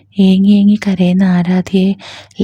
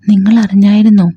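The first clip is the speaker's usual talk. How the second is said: breathless ask, lightly done; a touch out of breath, urgent news; panting question; 4 dB louder than usual. A narrator's reading. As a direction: ask it as if winded from running over. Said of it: "കിതച്ചോണ്ട് Anwar ചോദിച്ചു".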